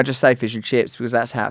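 usual speaking voice

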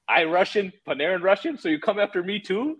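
high broken-English mock Russian; Russian accent imitation. Bobrovsky protesting to the coach, high mock Russian accent. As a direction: goalie indignantly protesting in a put-on Russian accent. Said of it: "Bobrovsky's like".

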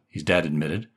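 ordinary delivery, nothing marked

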